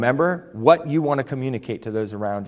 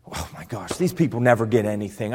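low, soft mock grumble; muttered exasperation; 6 semitones lower, 4 dB quieter than usual. Fed-up leader about people who never get it, exasperated.

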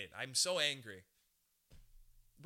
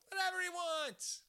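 higher, stretched mock echo of "Whatever he wants"; mock high put-on voice. Host mimics the jungle guy he'd let read to him, high playful voice.

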